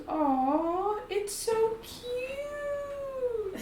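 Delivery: gushing coo, drawn right out; high-pitched cooing. Acted. Girl seeing her tiny summoned whip, high drawn-out cooing.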